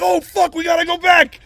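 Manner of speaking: loud, high panicked shout; acted-out alarmed yell; retold panic. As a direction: cry out as if disaster just dawned. Retold panic over possible fire.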